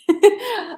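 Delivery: a sudden high burst of laughter; bright laugh. Real, not acted. Host laughing at her own grandma example, bursting into laughter. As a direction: laugh out loud at the idea.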